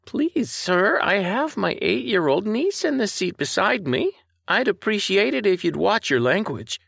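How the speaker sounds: put-on a grandmotherly scold with a slight Canadian accent; prim elderly Canadian lady. A narrator's reading. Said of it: "She had a slight Canadian accent".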